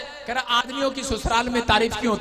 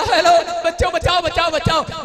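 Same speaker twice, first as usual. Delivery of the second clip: higher, pleading voice calling save me; comic plea of a principal in the water; hammed-up drowning principal calling to the kids; drowning principal crying for help; 8 semitones higher, 6 dB louder than usual. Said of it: "उसने बच्चों को देखकर बोला".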